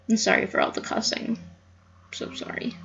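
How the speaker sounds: hushed whisper, almost gone; whispered apology. Real, not acted. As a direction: confess it under your breath.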